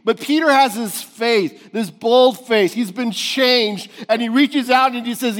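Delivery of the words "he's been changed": ordinary talk, nothing marked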